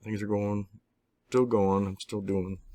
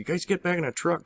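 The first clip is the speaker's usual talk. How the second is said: put-on high alarmed call to the kids; 11 semitones higher than usual. Father calls the kids back into the truck, sharply high. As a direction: shout them back into the truck.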